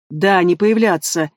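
plain reading voice

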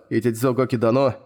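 plain reading voice

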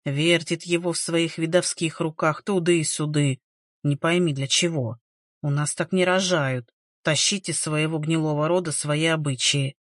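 put-on low, grumbling old village woman. A narrator's reading. Elderly relative scolding the midwife over the newborn, grumbling. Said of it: "заворчала одна из пожилых".

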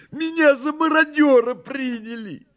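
very high, excited cat voice; joyfully excited cry. A narrator's reading. Behemoth exclaiming to messire, joyfully excited cry. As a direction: cry out high, bursting with glee. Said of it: "закричал возбуждённый радостно Бегемот".

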